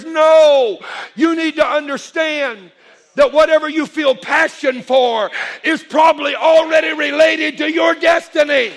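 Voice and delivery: loud, high preaching at full force; shouted affirmation. Real, not acted. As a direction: drive it home to the congregation. Preacher builds to climax.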